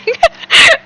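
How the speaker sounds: a squeaky high giggle that rises; high laugh. Real, not acted. Joking with a viewer about her manuscript, squeaky high giggle.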